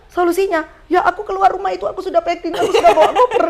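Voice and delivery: high-pitched, comic incredulous protest; flustered disbelief. Retold or imitated. Packed daughter answers unfazed mother, flustered disbelief. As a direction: act out a thrown daughter, voice way up.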